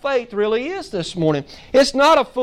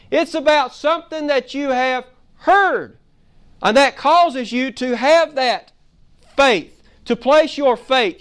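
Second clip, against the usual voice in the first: full force preaching; 5 semitones higher than usual. Real, not acted. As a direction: drive it home to the congregation.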